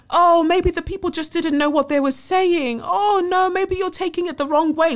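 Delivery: high, sing-song mock of a dismissive woman; mocking imitation. Mocking Italian woman's dismissive words.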